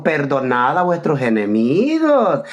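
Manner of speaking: cita bíblica grave y muy lenta, alargada; cita solemne. Retold or imitated. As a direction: declama el versículo despacio y más bajo de tono. Cita enfática en un debate. (cita solemne, lenta y grave)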